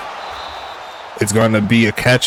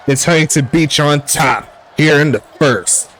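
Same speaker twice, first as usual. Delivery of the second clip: higher play-by-play, stretching the player's name; excited touchdown call; 5 semitones higher than usual. Real, not acted. Commentator calling Ryan the Diamond Davis's score, excited. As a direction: draw out the scorer's name in a raised announcer voice. Calls the scoring play live.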